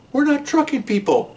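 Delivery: high pleading excuse; whiny protest. Retold or imitated. Cops answering the supervisor, high whiny excuse. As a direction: whine that it's not your job.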